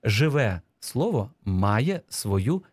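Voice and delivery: deliberate, one word at a time; slow dictation. Shown as a demonstration. Teacher reading the last dictation sentence, slow and word by word. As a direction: read each word separately for writers.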